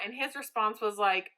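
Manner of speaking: ordinary talk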